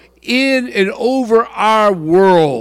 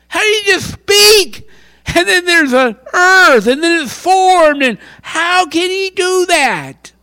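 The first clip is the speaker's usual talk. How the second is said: awed, near tears; 11 semitones higher, 4 dB louder than usual. Real, not acted. Overwhelmed by God's creation.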